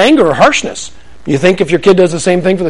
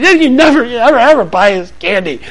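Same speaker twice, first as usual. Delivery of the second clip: put-on whiny kid; 5 semitones higher than usual. Kids whining.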